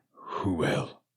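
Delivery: hushed, secret whisper; a master whispering the prophecy. Acted. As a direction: breathe it like a prophecy.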